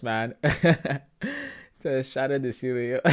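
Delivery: usual speaking voice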